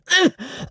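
choked sneezing cough; sneeze cough. A narrator's reading. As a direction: sputter between words. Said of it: "between two sneezes".

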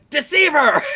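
yelling. Retold or imitated. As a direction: hurl the word like an accusation. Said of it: "We all need to yell that".

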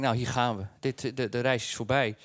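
low, quiet, defeated thought; resigned mutter. Retold or imitated.

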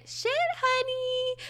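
high sing-song honey. Acted. Sung-out catchphrase sign-off.

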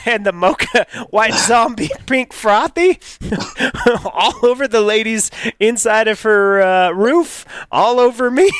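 giggling retelling; laughing while telling. Real, not acted. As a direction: crack up at the messy memory. Drink spills, he laughs telling.

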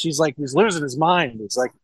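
ordinary talk

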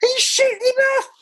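very high, laughing incredulous squeal; squeaky laughing disbelief. Retold or imitated. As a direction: squeal it through laughter at the referee. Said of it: "I was saying".

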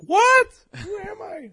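sudden loud, high shout. Retold or imitated. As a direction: yell it out in alarm. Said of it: "I just blew your mic out".

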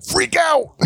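very high, strained yelp; wrestler catchphrase. Acted. Host recalling a wrestler's catchphrase, high strained impression. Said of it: "what's another thing he says".

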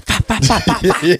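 rapid-fire mock gunfire, loud and high; imitated gunshots. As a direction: spit out bursts of pretend shots. Imitates gunshots to tease the storyteller.

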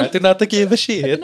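put-on French accent gone astray, nearer Italian; badly faked French accent. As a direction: repeat the botched French accent, amused. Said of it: "that was not French".

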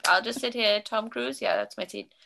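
playful mock Swedish chef gibberish; light hammed-up Swedish nonsense; fake Swedish accent. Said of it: "sneak in behind musicians going".

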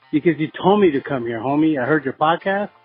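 normal talking voice

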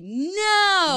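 loud, high, drawn-out refusal; emphatic no. Shown as a demonstration. Telling people who don't hear her refusals, loud emphatic no. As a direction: stretch it out so they finally hear it. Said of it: "they don't hear when I say".

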